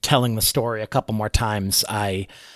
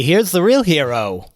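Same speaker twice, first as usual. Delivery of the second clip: louder, higher showman's boast; hearty proclamation; 6 semitones higher, 6 dB louder than usual. Acted. Bard hailing his comrade before the tavern crowd, booming praise. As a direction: announce the hero to the room.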